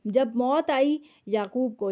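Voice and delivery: ordinary talk, nothing marked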